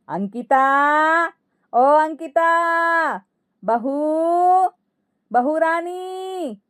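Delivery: high, long-drawn singsong summons; calling out. Acted. Mother-in-law summoning daughter-in-law before guests, calling out. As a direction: stretch each name out to carry to another room.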